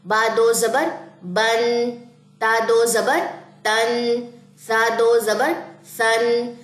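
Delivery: slow recitation. Shown as a demonstration. Teacher leads students through the qaida line, slow drill. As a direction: drill each letter slowly and clearly. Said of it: "سب سے پہلے توڑ جوڑ".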